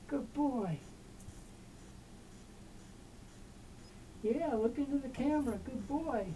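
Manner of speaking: squeaky praising a dog. Real, not acted. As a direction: coo praise at the cat. Praising the cat.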